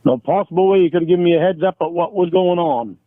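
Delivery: usual speaking voice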